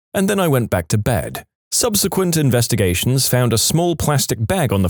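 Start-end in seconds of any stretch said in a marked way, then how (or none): none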